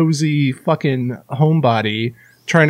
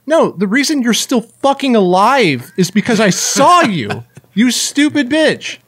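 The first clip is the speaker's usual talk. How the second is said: retold anger; 6 semitones higher, 3 dB louder than usual. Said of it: "I got so mad".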